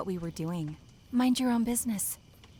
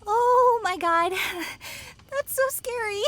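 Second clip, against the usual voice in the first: scared shriek; 7 dB louder than usual. Acted. Reacting to the murder threat.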